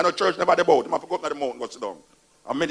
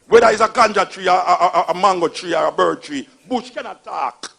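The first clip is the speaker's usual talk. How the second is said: laughing mockery; 3 semitones higher, 10 dB louder than usual. Real, not acted. Mocking the bush-attack belief.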